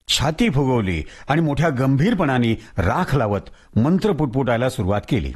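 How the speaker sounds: usual speaking voice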